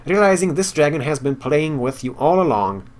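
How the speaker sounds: reading game text aloud like a storyteller; story narration. Acted. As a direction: narrate the quest scene with flair.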